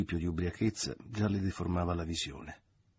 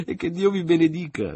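much higher and louder cry breaking into laughter; bursting laughter; 11 semitones higher, 11 dB louder than usual. A narrator's reading. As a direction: shout the blessing and burst out laughing. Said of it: "gridò Maria Grazia con uno scoppio di risa".

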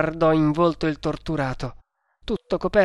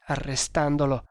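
usual speaking voice

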